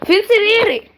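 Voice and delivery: indignant demand. Real, not acted. Demanding his missing bed.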